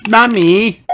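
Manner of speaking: little pig calling her mother; crying out. A narrator's reading. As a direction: call out to mummy. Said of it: "cried Peppa".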